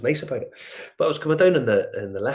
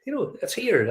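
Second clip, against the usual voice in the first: delighted surprise; 10 semitones higher than usual. Retold or imitated. Spots home whiskey abroad.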